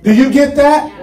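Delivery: ordinary talk, nothing marked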